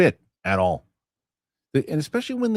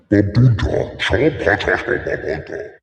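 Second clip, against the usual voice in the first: louder alien gibberish; mock Huttese; 3 semitones lower, 5 dB louder than usual. Host mimicking Hutt language, louder. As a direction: rumble made-up Hutt words.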